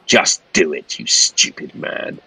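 low, hushed, menacing order; sharp low tone. Acted. Pinkham leans in to Philpott, sharp low order. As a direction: hiss it under your breath at an underling. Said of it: "with a sharp tone in his voice".

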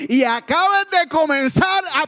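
high, building to a loud shout; impassioned, shouted pulpit charge; preaching at full force. Real, not acted. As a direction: exhort the church with rising intensity.

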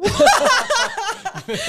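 loud, high burst of laughter; helpless laughter. Real, not acted. Laughs after acting the tearful exchange.

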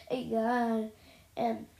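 ordinary delivery, nothing marked

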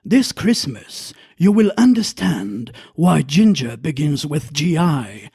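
deep, slow trailer voice; movie trailer voice. Acted. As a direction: sell it like an epic trailer. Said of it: "made-up intros".

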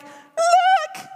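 put-on high, loud child's squeal; excited kids. Children playing with water, squealing high. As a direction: shriek it like a thrilled kid. Kids showing leaky can.

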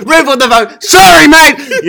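very high, loud mock outburst; mimicked angry yell. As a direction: bellow it, drawing out the sorry. Co-host mimics the yelling water boy.

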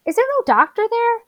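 high, fast disbelieving question; can't believe it, voice jumps way up; shocked high squeak; incredulous. Real, not acted. Flabbergasted at Greg fixing finger.

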